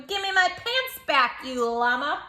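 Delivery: high, loud playful yell; mock shout. Child yells at the llama for the pants, high mock yell. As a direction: demand the pants back loudly.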